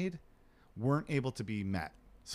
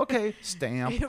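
mock clerk; 9 semitones higher, slower than usual. Mock clerk rubber-stamping.

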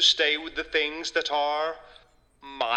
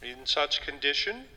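ordinary delivery

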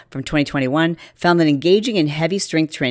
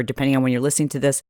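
usual speaking voice

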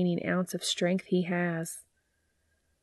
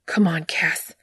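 rough desperate whisper; 4 dB louder than usual. A narrator's reading. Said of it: "voice rough with want and frustration".

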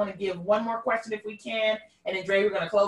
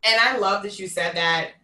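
ordinary delivery

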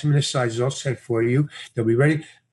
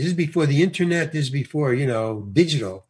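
usual speaking voice

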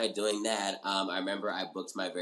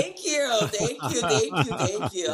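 gushing thanks; 12 semitones higher, 5 dB louder than usual. Real, not acted. Thanking repeatedly after compliment.

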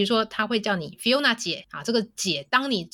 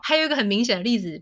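ordinary talk, nothing marked

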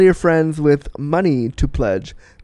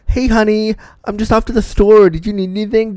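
higher-pitched, casual mom voice; chirpy mom on phone; 6 semitones higher than usual. Acted. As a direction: play a mother casually calling her kid. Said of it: "your mom calling you to be like".

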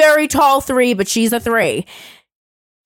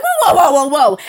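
alarmed whoa; 2 semitones higher, 4 dB louder than usual. Retold or imitated. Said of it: "I'm like".

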